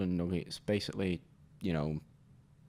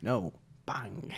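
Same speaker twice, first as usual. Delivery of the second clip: cereal mascot voice; 5 semitones higher, slower than usual. Acted. Voicing Cap'n Crunch threatening.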